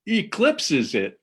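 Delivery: high, bright punchline delivery. Acted. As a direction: land the joke with a lift.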